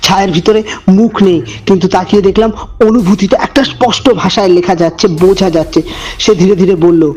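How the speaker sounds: low, hushed storyteller voice; eerie narration, measured and dark; horror story narration. A narrator's reading. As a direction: draw the listener into a creepy tale.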